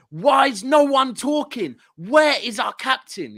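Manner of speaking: high, drawn-out exasperated shout; shouting from stands. Retold or imitated. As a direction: re-enact bellowing at the team, at moderate volume. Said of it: "I started shouting at the top of my voice".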